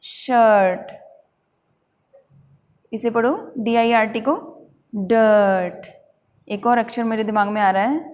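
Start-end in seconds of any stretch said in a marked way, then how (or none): 0.0-0.9 शर्ट as a long shuhht; silent r. Shown as a demonstration. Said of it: "आई की आवाज़ अ में हो जाती है". 4.9-5.9 डर्ट as a long duhht; silent r. Shown as a demonstration. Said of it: "आर silent हो जाता है".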